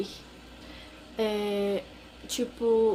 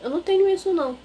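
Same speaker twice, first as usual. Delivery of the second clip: higher, louder, fast scoffing denial; mock denial; 7 semitones higher, 6 dB louder, much faster than usual. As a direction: mimic someone brushing it off. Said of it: "Não tem essa de dizer". (negação debochada, rápida e mais alta)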